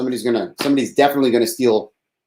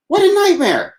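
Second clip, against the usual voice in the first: very high, loud mock wail; 5 dB louder than usual. Shown as a demonstration. Complaining about the cut, a high hammed-up wail.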